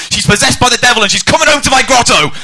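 high, loud, hammed-up declaration; mock proclamation. Ghost collector claiming a man's wife, mock proclamation. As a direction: proclaim it like a brazen con man.

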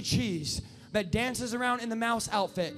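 normal talking voice

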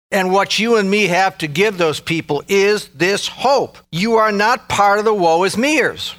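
high, loud rallying pulpit voice; impassioned exhortation, pitch climbing at the end; full-force preaching. Real, not acted. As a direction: rouse the congregation to action.

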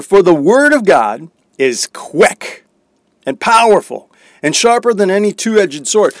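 loud, declaiming Bible reading; scripture reading. Acted. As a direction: proclaim the verse with force. Reads scripture aloud.

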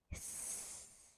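sharp unvoiced cat hiss. Cat hisses.

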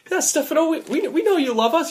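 very high-pitched, stammered reassurance; placating. Acted. Debtor placating sobbing bar owner, high-pitched.

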